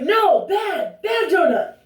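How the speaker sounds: high, loud scolding like at a dog; mock scolding trainer. Trainer scolding her about the donut, hammed-up loud and high. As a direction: scold the donut like a naughty pet. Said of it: "he was like".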